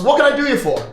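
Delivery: hearty barkeep. Acted. Barkeep greets stranger.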